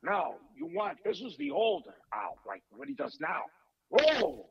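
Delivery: high, strained older film-star impression; Al Pacino. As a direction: draw out the exclamations like an older screen star. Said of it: "I gotta hear a little Al Pacino now".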